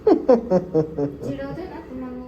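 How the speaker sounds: very slow sulking complaint; slow pouty sulk; sulky. Real, not acted. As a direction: drag it out like a sulk. Mock sulking at teasing.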